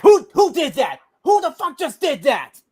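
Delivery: high, loud demand; angry shout. Retold or imitated. Moviegoers in the theater shout at the clapper, angry and high. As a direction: yell across the theater hunting the clapper. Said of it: "they'll be in the movie theater".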